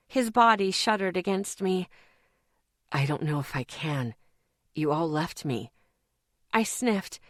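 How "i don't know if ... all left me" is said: exhausted, despairing man. A narrator's reading.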